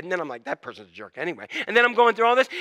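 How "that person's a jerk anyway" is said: low, quick, soft aside; quietly dismissive, tossed off fast; mad inner grumble. Acted. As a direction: grumble it under your breath. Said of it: "Then I get mad".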